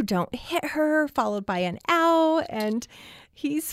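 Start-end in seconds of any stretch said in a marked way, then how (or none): 0.0-1.1 little brother's plea. Retold or imitated. Little brother voiced on tape. 1.9-2.4 put-on child's ow. Child's cry on tape.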